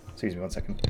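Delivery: ordinary delivery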